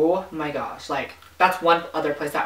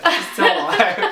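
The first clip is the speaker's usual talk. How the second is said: laughing; 9 semitones higher, 4 dB louder, slower than usual. Real, not acted. Teasing joke about absent friend.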